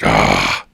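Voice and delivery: low, loud "ah" outburst; loud creature cry. Acted. Game master voicing Nanat's reaction, loud low cry. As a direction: bellow a short low "ah". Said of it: "And Nanat's like".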